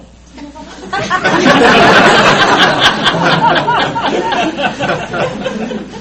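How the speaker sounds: high, loud, sustained laughter; roaring laugh at the father's quick exit; real long laugh. Real, not acted. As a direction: crack up at the punchline. Father hangs up after five minutes.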